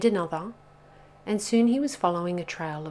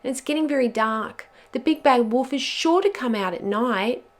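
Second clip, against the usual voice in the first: higher, louder fretful wail; anxious moan; 6 semitones higher, 7 dB louder than usual. A narrator's reading. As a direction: fret aloud about the danger. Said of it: "moaned Pog".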